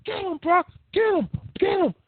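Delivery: high excited yelling voice; Heyman screaming. Retold or imitated. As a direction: egg him on in a shrill high yell. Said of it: "And Heyman screaming".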